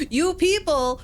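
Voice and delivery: high, incredulous echo; taken aback. Retold or imitated. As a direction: throw the words back in mock shock. Acted shock at being labelled.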